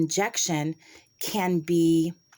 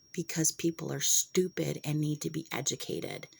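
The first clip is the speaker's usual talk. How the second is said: hushed, slightly softer whisper; whispered aside; 2 semitones lower, 5 dB quieter than usual. Real, not acted. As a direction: say it under your breath.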